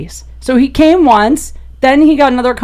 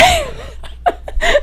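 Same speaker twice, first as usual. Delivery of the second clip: cracking up at her own story; a high, helpless laugh; high burst of laughter; 5 dB quieter than usual. Real, not acted. Teller bursting into high laughter at the punchline.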